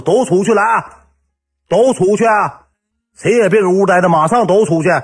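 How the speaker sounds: loud, high, stretched-out command; acted-out furious dismissal; shouted order. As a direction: clear the whole room with your voice. Partner orders everyone out, acted.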